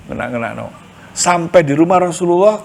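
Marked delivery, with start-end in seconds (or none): 0.1-0.7 "Ngenak-ngenak no" repeated lower and much softer; quiet echo of "Ngenak-ngenak no". Shown as a demonstration. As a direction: murmur "Ngenak-ngenak no" to yourself, low. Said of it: "Ngenak-ngenak no gitu loh".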